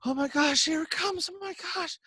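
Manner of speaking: high, hushed, panicky whisper behind the curtain; jittery high murmur of stage nerves; freaking out. Retold or imitated. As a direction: imitate a speaker panicking backstage. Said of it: "Like, you're freaking out".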